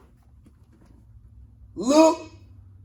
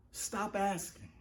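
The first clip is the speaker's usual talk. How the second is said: hushed repeat; 15 dB quieter than usual. Shown as a demonstration. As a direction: let it fall almost silent. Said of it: "stop asking repeated".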